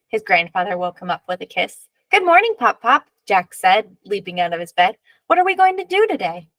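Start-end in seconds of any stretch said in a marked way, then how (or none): 2.1-3.1 put-on high, eager child's voice; bright morning hello from a small boy; cheerful little boy. Child greets grandfather happily. 5.3-6.5 put-on high, excited child's question; eager little boy. Eager boy asks grandfather.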